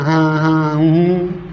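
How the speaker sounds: ordinary delivery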